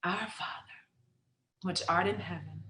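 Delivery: soft, low, near-whispered prayer; quiet, reverent recitation; hushed prayer. Acted. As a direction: murmur it privately as a bedtime prayer. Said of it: "said her prayers".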